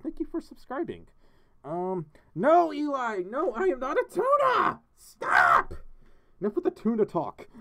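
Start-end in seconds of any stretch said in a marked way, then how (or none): 2.3-7.5 high, loud protest rising to a yell; exasperated shout. Real, not acted. Streamer fed up with chat's tuna teasing, exasperated shout. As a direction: shout it in mock outrage, building to the last word.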